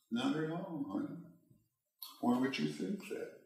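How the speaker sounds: soft, sweet and hushed. Retold or imitated. Husband soothing his anxious wife in the car, soft and sweet. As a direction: coat the answer in sweetness. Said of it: "softly, sweetly".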